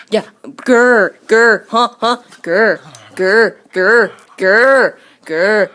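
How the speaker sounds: hammed-up dog growl; mock growl.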